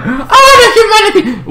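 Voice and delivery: high, hammed-up wail; mock lament. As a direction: wail it with fake tragedy. Mock lament at parents' death.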